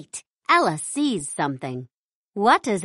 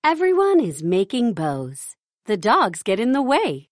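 plain reading voice

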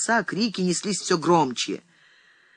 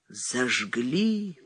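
understated cry of grief, lower and softer; sorrowful cry; 4 semitones lower, 5 dB quieter than usual. A narrator's reading. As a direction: lament it, drawn out. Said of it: "горестно вскрикнул Василий Васильевич".

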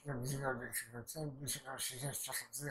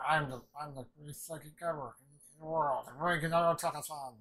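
loud boast, building higher; boastful villain; 3 semitones higher, 9 dB louder than usual. Retold or imitated. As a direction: proclaim it grandly as the mock villain. Villain boasts in a retold scene.

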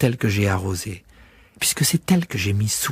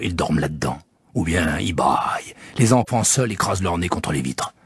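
low, weary switchman voice; tired, unimpressed railway man; the switchman describing the sleeping travellers; 6 semitones lower than usual. Acted. Switchman character.

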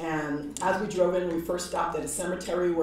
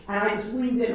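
ordinary talk, nothing marked

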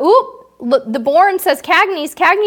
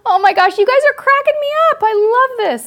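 much higher and louder, bubbling over; giddy delight; 12 semitones higher, 6 dB louder than usual. Real, not acted. Host cracking up at viewers' answers, high and giddy. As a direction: squeal it out, thrilled.

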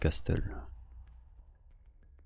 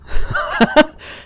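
breathy laugh. Real, not acted. Laugh alone.

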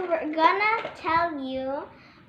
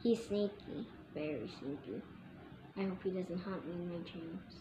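spooky hush; 17 dB quieter than usual. Pretend. Child hushes a spooky clue to viewers, hushed. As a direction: drop to a hushed, spooky half-whisper.

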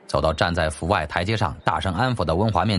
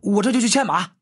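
a lightly voiced servant calling after his master, higher; hurried steward; 11 semitones higher than usual. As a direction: servant hurrying to catch up, calling out. (略带仆人腔的急切呼喊)

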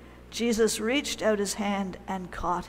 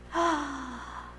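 gasp of relief; 5 semitones higher, 4 dB quieter than usual. Acted. Relief at Jesus' words.

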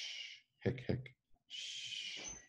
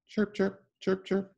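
high chirpy bird calls; chirping birds; 12 semitones higher, slower than usual. A narrator's reading. Reading a picture book to kids: high chirping. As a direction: chirp them like little birds. Bird sound read aloud.

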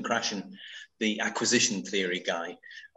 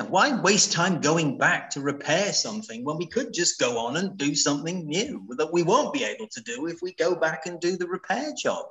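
usual speaking voice

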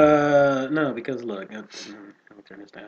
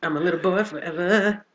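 Peter Pan voice; 7 semitones higher, 8 dB louder than usual. Pretend. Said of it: "Peter Pan".

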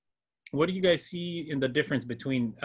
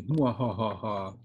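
mad scientist; 4 semitones lower, 4 dB quieter, much slower than usual. Pretend. Teacher leaving to mess with group.

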